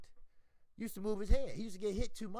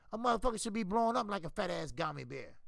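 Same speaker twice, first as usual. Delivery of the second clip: ordinary talk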